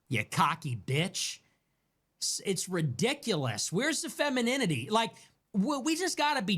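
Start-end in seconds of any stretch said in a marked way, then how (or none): 0.1-1.4 contempt. Real, not acted. Contemptuous insult.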